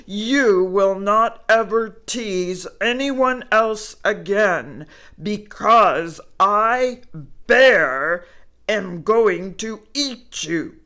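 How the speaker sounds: loud, menacing bear growl. Acted. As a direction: threaten the squirrel like a hungry bear. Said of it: "Bear growled".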